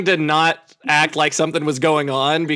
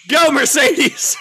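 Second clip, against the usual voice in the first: mock cheer; 8 semitones higher, 4 dB louder, much slower than usual. Cheering shout.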